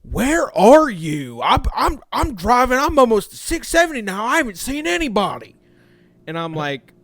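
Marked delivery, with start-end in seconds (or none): 0.0-5.5 higher, louder, impatient mock of the tow guy on the phone. Tow guy calls, can't find the stranded driver: high, loud, impatient. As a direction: play the annoyed tow guy calling, lost. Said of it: "get a call ... saying".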